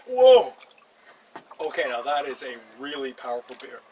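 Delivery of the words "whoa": sudden loud high whoa after a sip; startled whoa. Real, not acted. Vlogger gasps at his first sip of strong beer, loud startled whoa. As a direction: blurt it out, caught off guard by the beer.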